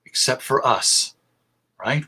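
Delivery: ordinary talk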